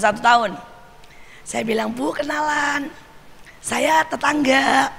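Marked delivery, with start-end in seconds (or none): 1.9-4.9 slow, drawn-out neighbourly greeting; friendly introduction. Retold or imitated. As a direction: stretch it out sweetly to win over a neighbour. (sapaan tetangga yang ramah dan mendayu)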